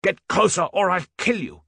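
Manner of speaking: furious high shout under fire; shouting threat. A narrator's reading. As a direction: yell it at him over the gunfire. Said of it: "Hals shouted".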